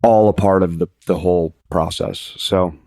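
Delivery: usual speaking voice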